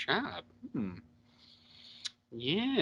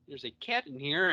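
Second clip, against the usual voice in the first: ordinary delivery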